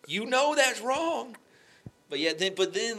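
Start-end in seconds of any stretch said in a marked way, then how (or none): 0.1-1.4 high, slow, drawn-out gentle chiding; slow high rebuke. Retold or imitated. The Holy Spirit reminding him, slow high chiding. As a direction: stretch each word like a knowing reminder. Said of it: "Kind of just like".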